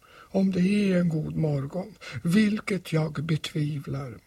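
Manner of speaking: high, whispery, doubtful voice, slowing at the end; gloomy donkey. Acted. Old donkey doubts the morning is good, gloomy. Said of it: "sa Ior dystert".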